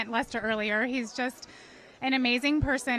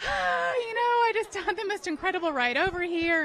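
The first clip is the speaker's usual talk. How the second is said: much higher, bubbling over with a laugh in it; excited gushing; 6 semitones higher than usual. Retold or imitated. Guest retells rushing in to tell Lester, high excited gush. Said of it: "I was like".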